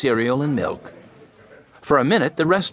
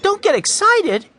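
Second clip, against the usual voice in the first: very high, louder angry yell; indignant yell; 5 dB louder than usual. A narrator's reading. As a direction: yell the words back in outrage. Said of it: "yelled Mike".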